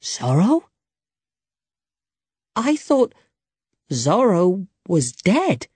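amazed, slow, with long gaps; stunned disbelief. A narrator's reading. Young man realises the old man is Zorro, stunned.

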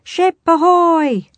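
high, drawn-out holler; lookout's cry. A narrator's reading. Pirate in crow's nest hails a sighting, hollering. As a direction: call it out long and loud. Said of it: "a cry came from a pirate".

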